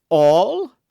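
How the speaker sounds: a sudden loud high All?; surprised. A narrator's reading. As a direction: jump at the word in surprise. Porthos surprised at inheritance.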